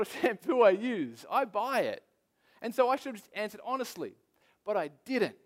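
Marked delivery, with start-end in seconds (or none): none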